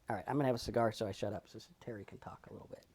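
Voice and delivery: quick soft whisper; hurried aside under the breath. Real, not acted. Whispered aside before handing over.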